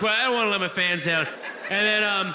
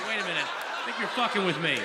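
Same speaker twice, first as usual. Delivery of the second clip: ordinary delivery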